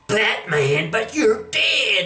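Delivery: high, triumphant villain crowing; hammed-up gloating crook. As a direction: crow over the victory. Two-Face gloating over Batman.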